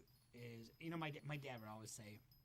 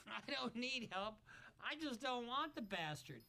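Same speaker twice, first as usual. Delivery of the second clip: mock outraged mother; 8 semitones higher than usual. Host repeating the mother's refusal, high mocking mimicry.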